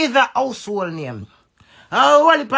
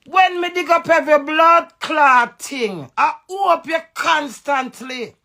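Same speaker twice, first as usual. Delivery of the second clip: angry outburst; 5 semitones higher, 4 dB louder than usual. Real, not acted. Angry rant at a rival.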